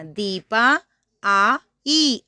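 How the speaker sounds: ordinary delivery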